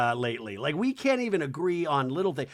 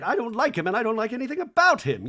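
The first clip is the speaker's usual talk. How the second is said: raised, emphatic objection; mock indignation; 7 semitones higher, 5 dB louder, faster than usual. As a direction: imitate a firm, indignant refusal. Acting Bronwyn's firm objection.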